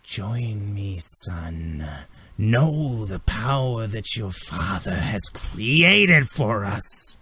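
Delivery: slow, drawn-out villainess beckoning; villainous mother. Acted. Spore figure calling her son to join her, slow and beckoning. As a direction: lure them in with slow, stretched words. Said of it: "she says".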